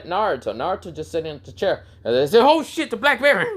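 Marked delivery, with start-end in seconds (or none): 2.4-3.5 high, startled acted exclamation; sudden comic panic, pitched way up; alarmed cry. Senseis spot a huge bear.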